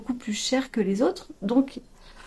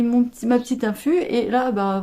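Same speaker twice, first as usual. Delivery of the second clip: normal talking voice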